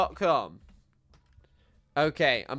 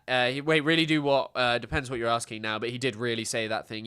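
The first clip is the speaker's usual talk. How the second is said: ordinary talk, nothing marked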